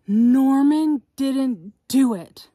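slow, loud gasped title reveal; grand reveal of the title; dramatic title. Acted. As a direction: gasp then announce the title big.

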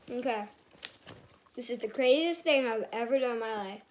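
ordinary talk

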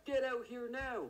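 high shout at the suspect; shouting at the house to come out; yelling out. Acted. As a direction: call him out of the house, loud. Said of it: "So you should keep yelling".

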